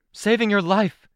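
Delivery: high, loud retort; shouting back. A narrator's reading. Mom answering an accusation mid-battle, shouting back. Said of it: "my mom shouted back".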